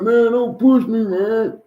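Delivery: loud, high mock complaint; imitated whiny protest. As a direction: protest like a squeaky-voiced kid. Voicing the fat boy in quarrel.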